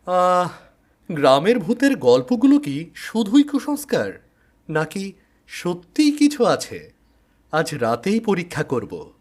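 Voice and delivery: a curious man itching to test the legend; eager investigator. Acted. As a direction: investigator musing before his night test. Investigator character voice.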